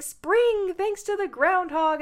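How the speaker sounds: high excited yell; mock chipmunk cheer. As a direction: call it out like an eager chipmunk. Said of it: "the chipmunk is yelling".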